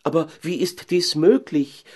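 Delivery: high-pitched mayor's wife exclaiming; incredulous cry. Acted. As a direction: call out in disbelief, voice lifted. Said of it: "rief die Frau Bürgermeister". (ungläubig ausrufen)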